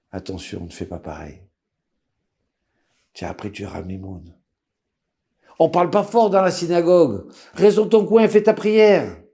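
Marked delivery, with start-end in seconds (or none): 0.1-4.4 hushed warning to himself; soft inner voice, almost under the breath; quiet inner warning. Retold or imitated. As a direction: murmur it as a self-reminder.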